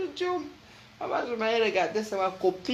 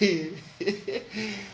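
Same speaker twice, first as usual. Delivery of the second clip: laugh; 2 semitones higher, 6 dB quieter than usual. Real, not acted.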